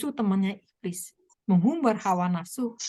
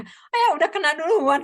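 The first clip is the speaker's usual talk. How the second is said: a sudden, louder, higher cry of surprise; startled exclamation; 6 semitones higher, 6 dB louder than usual. Retold or imitated. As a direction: blurt it out as if just caught off guard. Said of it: "kata saya".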